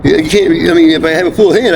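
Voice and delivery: ordinary talk, nothing marked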